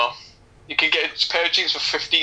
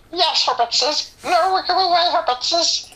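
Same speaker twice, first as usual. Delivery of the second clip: much higher, slow creature voice with hissing plurals; Gollum; 14 semitones higher, slower than usual. Retold or imitated. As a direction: shriek it out like a cave creature. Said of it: "I can do impression of Gollum".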